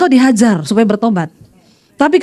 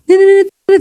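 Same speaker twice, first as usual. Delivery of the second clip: high, repeated phone beeps by mouth; imitated phone ringing; 6 semitones higher, 3 dB louder than usual. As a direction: mimic a ringing phone in short high notes. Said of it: "telepon saya bunyi dong". (tiruan bunyi telepon berdering)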